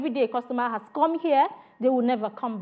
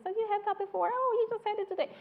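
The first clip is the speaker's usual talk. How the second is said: high, soft voice for a friendly rep; acted customer chat; 8 semitones higher, 6 dB quieter, faster than usual. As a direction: lift the pitch high and keep it light and quiet. Acting rep chatting with customer.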